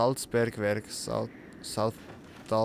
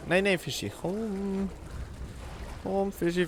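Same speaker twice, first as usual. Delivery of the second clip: high cooing voice; 6 semitones higher than usual. Pretend. Cooing to a pet fish.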